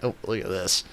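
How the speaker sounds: usual speaking voice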